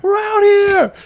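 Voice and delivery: amped road-trip cheer; pumped up. Retold or imitated. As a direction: shout it like the road trip just started.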